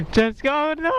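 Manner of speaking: high excited yell. Real, not acted. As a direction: yell it out, pitch shooting up. Pitch jumps while urging.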